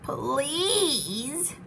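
long, drawn-out plea; pleading, with the word held long; begging. A narrator's reading. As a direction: stretch it into a wheedling beg. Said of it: "begged the bird".

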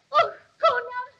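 loud, high anguished outcry; stabbed sister's last scream; dying cry. Acted. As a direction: cry out as the blow lands.

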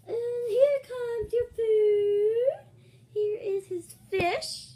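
high, loud, drawn-out call; slow, showy high-pitched serving announcement; waitress announcing food. Pretend. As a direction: sing out the order as a pretend waitress.

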